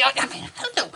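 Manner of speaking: unvoiced incredulous whisper. Real, not acted. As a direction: breathe it in disbelief. Whispered aside.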